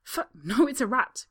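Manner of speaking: loud, high alarmed cry. Acted. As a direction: shriek out the news. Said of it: "cries a woman".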